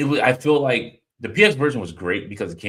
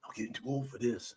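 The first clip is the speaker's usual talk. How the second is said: Danny Glover line; 3 semitones higher, 12 dB quieter than usual. Acted. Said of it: "fifty was Danny Glover".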